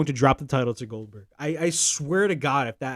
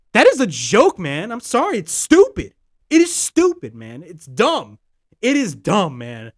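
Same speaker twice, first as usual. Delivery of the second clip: exasperated outburst; 7 dB louder, slower than usual. Real, not acted. Podcaster ranting to listeners about WWE booking, exasperated. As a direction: spit out each verdict.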